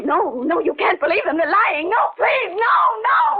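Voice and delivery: building from pleading to a high crying shout; desperate pleading. Acted. Sybil begging not to be committed, rising to crying shouts. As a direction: beg them, voice rising into a sob and scream.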